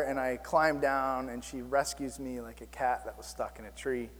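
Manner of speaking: normal talking voice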